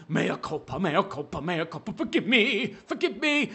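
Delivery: mocking what real begging would sound like. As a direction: grovel on your knees, mockingly. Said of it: "Isn't begging like you get down on your hands".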